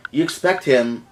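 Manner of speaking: usual speaking voice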